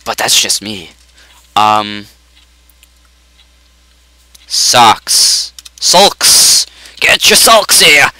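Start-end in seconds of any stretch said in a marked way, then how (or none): 5.8-8.1 high, voiced sales cry; hammed-up hawker's call; mock street hawker. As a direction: call it out like a vendor hawking wares. Hawking socks like a street vendor.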